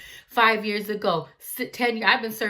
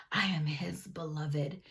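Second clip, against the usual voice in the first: whispered; 5 semitones lower, 9 dB quieter than usual. Real, not acted. Intimate realization at the mirror.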